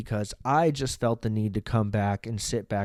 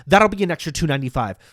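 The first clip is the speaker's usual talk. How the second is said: fast, loud, higher cashier rattle; rushed cashier; 6 semitones higher, 9 dB louder, faster than usual. Retold or imitated. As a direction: snap out the price like a hurried cashier. Worker announcing guac price.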